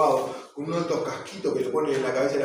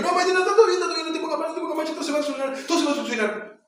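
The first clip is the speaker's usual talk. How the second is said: much higher, hammed-up soothing; mock consoling; 14 semitones higher, 5 dB louder, faster than usual. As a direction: play the over-eager comforter, pitched way up. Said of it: "yo digo". (consuelo exagerado, con voz mucho más aguda)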